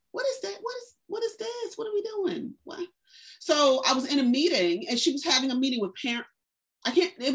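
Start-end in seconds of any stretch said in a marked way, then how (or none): 0.1-2.9 high-pitched incredulous questions, pulled back in volume; baffled disbelief. Retold or imitated. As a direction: retell your stunned confusion, pitch way up but hushed. Retold baffled reaction to observations.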